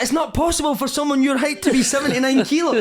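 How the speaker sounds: put-on very high, incredulous Italian accent; Paolo Di Canio. Italian manager shocked at his player's size, Italian accent. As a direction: gasp it like an appalled Italian coach. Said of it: "he's like".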